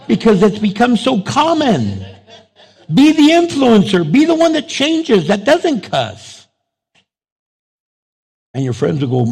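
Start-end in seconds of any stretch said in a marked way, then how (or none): 2.9-6.5 loud, high exhortation; preaching at full force. Real, not acted. Pastor urging the congregation, loud and high. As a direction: call the church to change.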